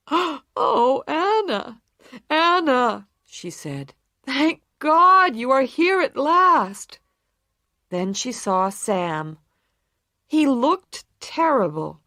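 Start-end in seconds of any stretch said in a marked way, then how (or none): 0.1-7.0 tearful relief. A narrator's reading. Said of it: "She was crying".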